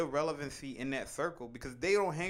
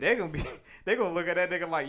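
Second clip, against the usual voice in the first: laughing; 5 semitones higher, 9 dB louder, faster than usual. Real, not acted. Laughing while telling.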